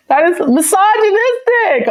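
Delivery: high-pitched put-on indignation; mock outrage. Calling out their own joke, in high mock outrage.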